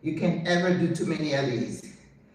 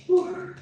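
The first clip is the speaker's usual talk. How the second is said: effortful whew; 14 semitones higher than usual. Real, not acted. Exhaling after exercise.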